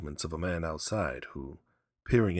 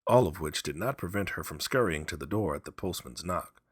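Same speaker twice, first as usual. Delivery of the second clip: usual speaking voice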